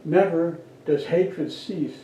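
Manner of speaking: normal talking voice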